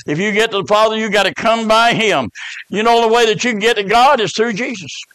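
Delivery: ordinary talk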